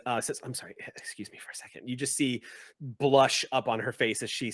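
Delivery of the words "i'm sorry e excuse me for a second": nervous apology. Acted. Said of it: "nervously turns on her heel".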